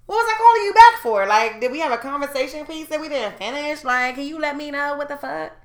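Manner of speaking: high, loud retold challenge; indignant rant. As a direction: demand an answer in exasperated disbelief. Acts out indignant argument with boyfriend.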